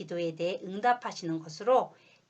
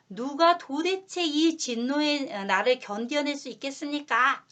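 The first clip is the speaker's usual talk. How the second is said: higher, louder, slow anguished cry to the sky; desperate outcry; 6 semitones higher, 6 dB louder, slower than usual. Retold or imitated. People of the world crying out to the heavens, louder and slower. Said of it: "라고 소리를 지르며 발악했던 것입니다".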